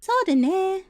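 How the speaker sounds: light, high agreeable voice. Shown as a demonstration. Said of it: "it's a little higher".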